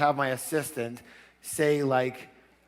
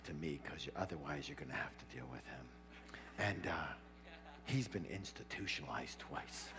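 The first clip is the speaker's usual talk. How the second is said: quiet, confiding good cop, much softer; hushed good cop; 8 semitones lower, 14 dB quieter than usual. Retold or imitated. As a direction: lean in and coax him softly. Good cop talking quietly to suspect.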